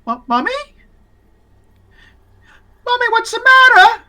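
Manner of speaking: put-on very high child calling to Mommy; high-pitched, anxious boy voice; upset little boy. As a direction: go from loud protest to worried calling. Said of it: "He's like".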